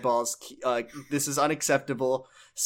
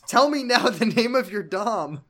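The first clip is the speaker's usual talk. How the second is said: high, insistent plea; theatrical urgency; mock desperate demand; 7 semitones higher, 3 dB louder than usual. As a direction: demand it like a hammed-up interrogation. Acts out frantic forum pleas.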